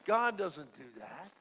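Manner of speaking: ordinary talk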